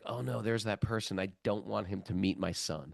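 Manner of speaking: low muttering under the breath; muttered dread. Retold or imitated. Father spotting the awkward devotee, muttering in dread. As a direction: mutter it, hoping he walks past.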